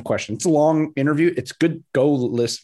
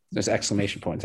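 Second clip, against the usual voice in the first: ordinary talk, nothing marked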